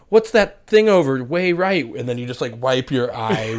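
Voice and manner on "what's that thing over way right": distracting loud question. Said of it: "And then you're like".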